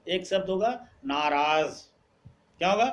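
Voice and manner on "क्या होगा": normal talking voice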